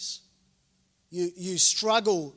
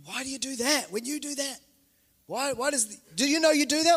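higher-pitched, mock family nagging; pitched-up questioning, acted out; exasperated relative; 5 semitones higher than usual. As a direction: voice a relative's puzzled challenge. Family member challenging a blind spot.

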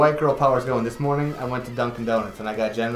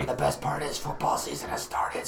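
whisper; 6 dB quieter than usual. Pretend. Whispered segment.

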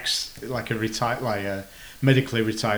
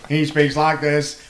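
robot voice; 3 semitones higher, much slower than usual. Retold or imitated. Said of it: "In an actual robot voice".

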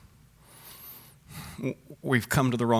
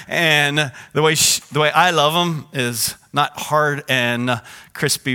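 usual speaking voice

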